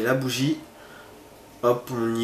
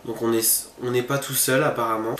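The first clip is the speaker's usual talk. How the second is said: normal talking voice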